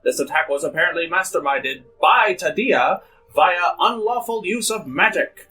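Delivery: put-on grand old ballroom announcer; old-timey announcer. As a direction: boom it like vintage radio host. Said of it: "Go to the old-timey announcer".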